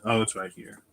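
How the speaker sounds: ordinary talk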